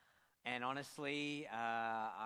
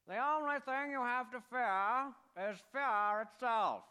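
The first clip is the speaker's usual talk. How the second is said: put-on higher, louder, slow old-radio oratory; Roosevelt impression; 11 semitones higher, 7 dB louder than usual. Pastor plays a guessing game, high slow Roosevelt voice. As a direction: boom it like a fireside speech. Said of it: "do my best impression of a U S president".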